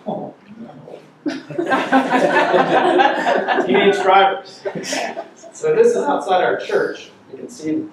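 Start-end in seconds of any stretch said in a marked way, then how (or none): 0.1-4.6 laughing, high and loud. Real, not acted. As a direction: laugh through the words. Laughing at the car wreck photo.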